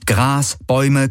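plain reading voice

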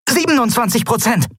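high-pitched alarmed shout; shouted battle status report; urgent shouted report. A narrator's reading. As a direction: call it out over a blaring siren. Damage report over shrieking siren.

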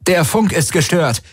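high, urgent report; calling out. A narrator's reading. Tari reports failed comms to Kirai, urgent call. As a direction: call it out over the chaos. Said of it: "rief Tari".

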